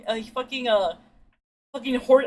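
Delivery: ordinary talk, nothing marked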